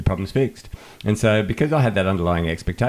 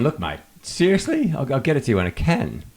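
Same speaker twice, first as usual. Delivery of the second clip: exasperated, higher retort at a nagging colleague; mock irritated retort; 8 semitones higher than usual. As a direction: tell him off, half-annoyed. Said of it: "And I got really angry".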